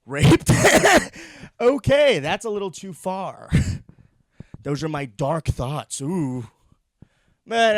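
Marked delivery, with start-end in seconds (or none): 0.5-1.5 loud high burst of laughter; big shocked laugh. Real, not acted. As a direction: crack up at your own joke. Laughs at own dark joke.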